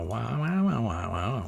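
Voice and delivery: wobbly sung wah wah, pitch swooping up then down; imitating warped Greek music. Telling a friend how the warped Greek record sounded, wobbly wah imitation. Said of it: "Greek music that already sounds like".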